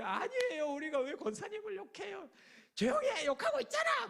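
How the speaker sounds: high, loud yelling back and forth; fighting ladies shouting each other down; shrill quarrel. Retold or imitated. 권사님들 싸움으로 번짐. (언성 높여 싸우는 고함)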